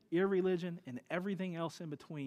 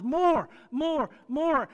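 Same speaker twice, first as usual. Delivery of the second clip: loud, high, insistent chant of more; demanding infant; 9 semitones higher, 12 dB louder, much slower than usual. Retold or imitated. Preacher mimicking a greedy infant, loud insistent chant. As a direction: mimic a baby banging for more.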